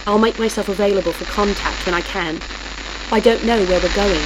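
hurried voice on a failing radio; dissolving into static. A narrator's reading. Butler signing off to boss, dissolving into static. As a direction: get it out fast as static swallows it. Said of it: "His voice dissolved into pure static".

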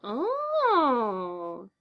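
high drawn-out creepy sing-song; the Joker savouring the moment. Acted. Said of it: "His voice was strange, shivering up and down in a sing-song".

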